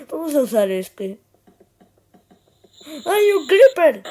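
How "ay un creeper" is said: sudden high yelp of fright; startled shriek. Acted. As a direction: shriek at the creeper. Creeper suddenly appears in game.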